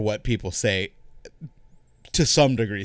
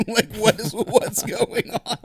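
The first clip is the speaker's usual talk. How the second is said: usual speaking voice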